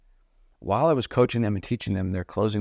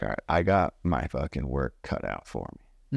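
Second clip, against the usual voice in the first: low muttered resolve; 6 semitones lower than usual. Retold or imitated. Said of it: "And I'm like".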